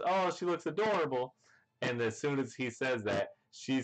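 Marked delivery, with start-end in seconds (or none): 0.1-1.4 lightly voiced high, swooning aside; smitten. Sanji admiring Pudding in her outfit, a light high swoon. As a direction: gush over her, pitched up. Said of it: "Sanji just says".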